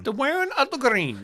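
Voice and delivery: high, loud mock Irish lilt; a jokey Irish brogue, badly done; bad Irish accent. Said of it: "That's a bad Irish accent".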